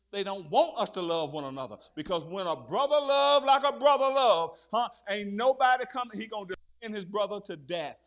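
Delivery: loud, rising sermon cry; fiery preaching. Real, not acted. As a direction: preach it out with full force. Preaching at full force.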